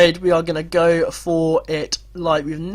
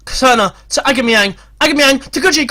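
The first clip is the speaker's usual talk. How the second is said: loud, high play-by-play; urgent commentary; 6 semitones higher, 5 dB louder than usual. Real, not acted. Commentator follows the final attack, loud and urgent. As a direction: call the passes with rising excitement. Last attack before penalties.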